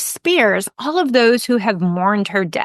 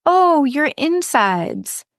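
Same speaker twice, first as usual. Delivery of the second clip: high, slow moan echoing her pain; sympathetic moan; 6 semitones higher, much slower than usual. Acted. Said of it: "They moan right back".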